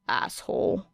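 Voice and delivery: whispered sulky insult; muttered insult. A narrator's reading. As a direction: grumble it half to yourself. Insult muttered after teasing.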